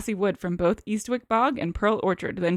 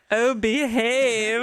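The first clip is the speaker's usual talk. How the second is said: slow, drawn-out cheeky British catchphrase; Austin; 3 dB louder, much slower than usual. Acted. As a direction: stretch it out in a hammed-up British voice.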